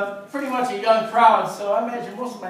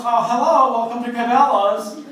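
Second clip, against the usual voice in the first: salesman voice; 3 semitones higher, 7 dB louder than usual. Retold or imitated. Salesman Biff greets customer.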